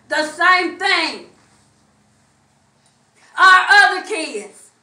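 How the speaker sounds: loud, fiery testimony preached to the church; preaching at full force. Acted. Pastor testifying to his congregation, preaching at full force. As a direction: drive every line home like a revival preacher.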